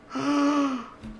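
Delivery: long, high gasp; shocked gasp. Real, not acted. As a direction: draw in a long gasp of surprise. Sees the Sims flirting.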